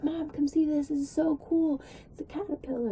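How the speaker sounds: put-on thrilled kid calling her mom over; excited call. Kid calling mom over to a caterpillar, excited high call.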